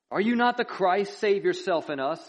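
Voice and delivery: loud, high-pitched jeering challenge; railing taunt. A narrator's reading. Criminal railing at Jesus on the cross, loud taunting. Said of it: "railed at him".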